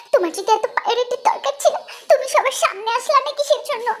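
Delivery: high, laughing, hammed-up scolding; mock angry wife. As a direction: whine at the husband half-laughing. Wife quarrels with husband.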